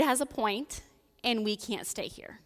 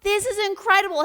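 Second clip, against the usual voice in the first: high, loud gasp of wonder; awestruck Peter; 12 semitones higher, 9 dB louder than usual. Retold or imitated. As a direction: burst out in amazement. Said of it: "he's like".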